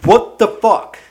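loud incredulous what the fuck; exasperated outburst. Real, not acted. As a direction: blurt it out fed up. Outburst at job title.

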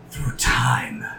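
low, hushed dramatic whisper. Acted. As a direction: breathe it like a secret.

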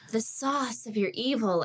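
put-on mistaken American accent with SAUCE in an open AH vowel and no R; American-style SAUCE where source was meant; botched American line reading; bad American accent. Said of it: "my bad American accent".

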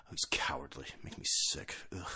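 low, whispery revulsion; hushed, put-on disgusted tweeter; mock disgust. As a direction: mutter it under the breath, sickened.

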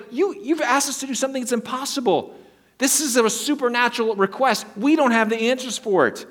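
high-pitched, incredulous pleading; acted-out flustered objection; exasperated protest. Chaldeans protesting an impossible request.

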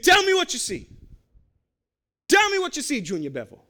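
a shaky attempt at a Jamaican accent; bad Jamaican accent. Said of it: "my Jamaican accent's terrible. It's more Irish".

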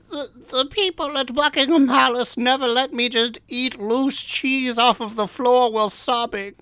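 high, put-on royal voice; hammy high-pitched queen impression; Queen Elizabeth voice. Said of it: "Liz said".

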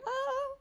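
high, soft squeal of glee; excited squeal. Real, not acted. Guest excited for the Gilmore Girls reboot, excited squeal. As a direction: let out a little high squeal. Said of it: "I'm so excited for the reboot".

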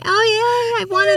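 put-on very high, giddy child's squeal; squealing kid. Mocking a friend as a kid thrilled by rewrapped presents, squealing high. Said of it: "And you're like".

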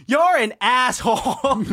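hammed-up repeat, pitch up and laughing. Retelling the movie girlfriend's outburst, a laughing mock yell. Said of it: "says it again, even louder".

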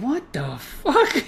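slow, laughing, incredulous mock reaction; baffled disbelief. Boxing coach watching Kung Fu titles, slowly laughing in disbelief. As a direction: drag it out like you can't believe your eyes. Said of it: "he's looking at it saying".

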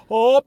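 high, loud mock "oh" of fullness; hammed-up bloated exclamation; imitated puffed-up groan. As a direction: let out a loud, overstuffed "oh". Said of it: "And I was going".

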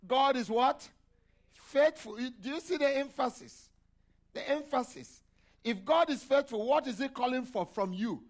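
loud, high-pitched call-and-response; preaching at full force. Acted. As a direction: fire the questions at the room, loud and high. Preacher raising voice to congregation.

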